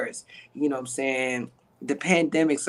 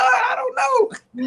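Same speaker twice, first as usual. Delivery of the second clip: much higher and louder, breaking into a laughing cry; excited laughing shout; 3 dB louder, much slower than usual. Real, not acted. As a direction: burst out joyfully, half shouting. Telling friends his secret, laughing.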